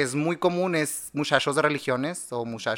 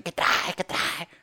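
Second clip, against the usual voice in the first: whispered sniffing; 3 semitones higher, much slower than usual. Retold or imitated.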